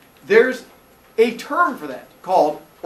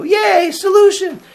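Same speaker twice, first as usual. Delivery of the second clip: cheering crowd; 9 dB louder, slower than usual. Retold or imitated. Villagers cheer mockingly.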